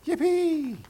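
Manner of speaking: very high, loud joyful squeal; happy yippee. Acted. Rabbit leaping for joy, squealing yippee. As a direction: leap into the air with a squeal. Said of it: "Rabbit two leaps happily into the air".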